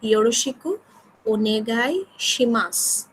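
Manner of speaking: ওনেগাই শিমাস drawn out slowly, each syllable clear; very slow model. Shown as a demonstration. Teacher models the closing phrase slowly to the class. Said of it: "সর্বশেষে বলছি".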